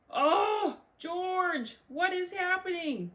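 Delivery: loud, high-pitched motherly wail; exasperated dismay. Acted. Mother baffled by mooing George, high dismay.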